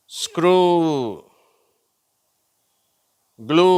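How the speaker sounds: words from "ஸ்க்ரூ" to "கேங்கரு" loud, each ending on a rising oo; long oo drill. Shown as a demonstration. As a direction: model each word alone, holding the final oo for repeating. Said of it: "ஊ என்ற ஓசை".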